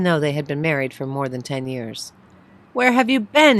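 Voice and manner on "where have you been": louder, higher, angry question. Acted. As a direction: demand it angrily, worried. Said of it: "he asked angrily".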